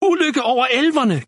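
high-pitched, urgent cry of disaster; gasped alarm. A narrator's reading. Rider brings bad news to the elves, gasping in alarm. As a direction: raise the alarm, pitched well up. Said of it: "gispede han".

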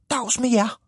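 high-pitched little hero calling hello; small character's high hail; cheery shouted greeting. A narrator's reading. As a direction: call out high to a crowd. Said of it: "råbte Tippetophat".

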